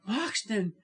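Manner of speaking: high, insistent repetition; urgent, high-pitched impatience; impatient. A narrator's reading. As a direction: snap the name twice in a high voice. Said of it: "she answered impatiently".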